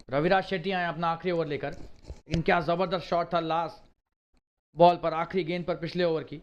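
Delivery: normal talking voice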